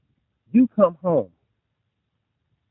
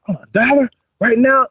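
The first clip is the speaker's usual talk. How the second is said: excited daughter; 7 semitones higher than usual. Retold or imitated.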